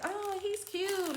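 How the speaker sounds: high, soft, drawn-out imitation of a friend; cooing admiration. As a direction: coo it slowly and high. Voicing friend admiring the boy.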